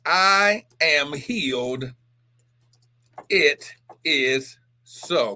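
very slow, spaced-out declaration; declaration of faith. Shown as a demonstration. Preacher modelling a confession for viewers, slow declaration. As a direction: declare it word by word for others to repeat. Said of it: "I just want you to say this".

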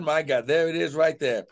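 ordinary talk, nothing marked

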